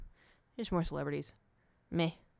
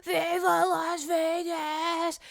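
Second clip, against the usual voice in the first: slow, drawn-out mock scream, much higher and louder; imitating a scream; 8 semitones higher, 3 dB louder, much slower than usual. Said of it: "he was screaming into the microphone".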